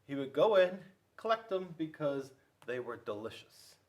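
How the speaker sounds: ordinary delivery, nothing marked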